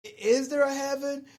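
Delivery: ordinary talk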